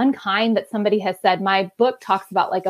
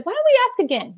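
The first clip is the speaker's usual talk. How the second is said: high, bright coaxing to her son; encouraging; 12 semitones higher, faster than usual. Retold or imitated. Mom gently encouraging her son to ask God again. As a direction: lift it up to gently coax a child. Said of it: "And so I said".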